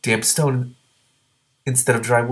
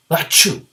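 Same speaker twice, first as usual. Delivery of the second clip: sneeze; 8 semitones higher, 6 dB louder than usual. Acted. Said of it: "Every time the gray green, green dragon sneezed".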